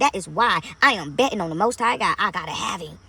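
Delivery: ordinary delivery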